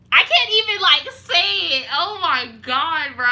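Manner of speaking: can't even say it, overwhelmed; worked-up outburst. Real, not acted. Imagining catching her man, loud and worked up.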